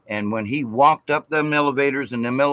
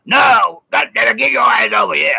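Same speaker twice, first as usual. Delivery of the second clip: very high, loud put-on holler; shouted impression of a gruff Southern sergeant; 13 semitones higher, 7 dB louder than usual. Navy sergeant asking about his Georgia youngins, high hollered imitation. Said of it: "he talked like this".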